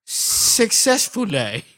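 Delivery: put-on slow, high robotic woman in a garbled European accent; muddled foreign accent. Podcaster mimicking the microphone's voice prompt, in a slow muddled foreign accent. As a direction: mimic the device's badly accented announcement, drawn out and high. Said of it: "listen to her talk".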